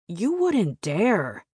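high, drawn-out mock yell; indignant yell. A narrator's reading. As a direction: call it out slowly with a high swoop. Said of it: "When I hear Johnny yell".

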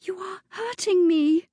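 high, fearful protest; girl in pain crying out to her attacker; frightened cry. Acted. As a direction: beg him to let go, scared. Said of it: "The girl's voice held a thread of fear".

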